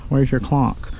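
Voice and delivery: ordinary delivery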